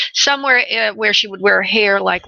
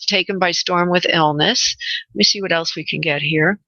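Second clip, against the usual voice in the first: ordinary talk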